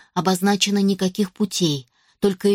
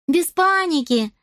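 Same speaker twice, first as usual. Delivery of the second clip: put-on high, slow elf girl cry; christmas elf; 9 semitones higher, slower than usual. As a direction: exclaim it like a pleased elf. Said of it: "воскликнула эльфоподобная девочка".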